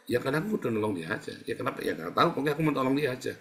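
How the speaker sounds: quick, brushed-off mumbling answers; defensive excuse. Acted. A helper voiced by the preacher, hurrying through excuses. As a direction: rattle off the excuse fast.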